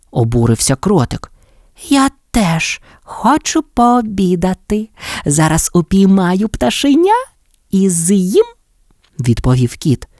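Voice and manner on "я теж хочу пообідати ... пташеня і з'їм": higher, slow sly cat voice; a greedy cat eyeing the chick. A narrator's reading. Cat tells mole he'll eat the chick, sly.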